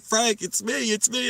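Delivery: high, loud mock-frantic reassurance; imitated urgent plea. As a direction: imitate a friend pleading over the screams. Friend calming a screaming man.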